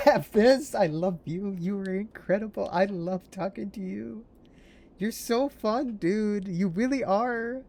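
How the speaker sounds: high, loud gushing affection; shower him with delighted praise; giddy high fan voice. Real, not acted.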